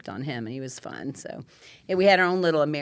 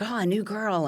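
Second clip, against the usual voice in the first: delighted greeting; 6 semitones higher than usual. Retold or imitated. New friends greeting her, acted quote.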